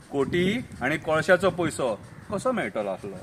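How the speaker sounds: soft, low-pitched echo; low aside. Acted. Narrator repeats the coal money line, low aside. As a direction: drop it to a confiding undertone.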